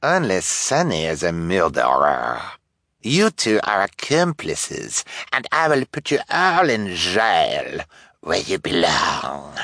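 slow, affected villain in disguise; hammed-up detective with odd drawn vowels; smug fake detective. A narrator's reading. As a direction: gloat over the accused, drawing words out.

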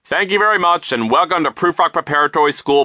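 high, loud, self-important speech voice; pompous vice principal. A narrator's reading. Vice principal welcoming children after applause, loud and pompous. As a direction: address them loudly like an adoring audience.

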